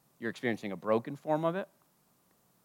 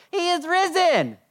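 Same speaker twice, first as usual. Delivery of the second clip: mock church declaration; 6 dB louder, slower than usual. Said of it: "coming to church environments and going".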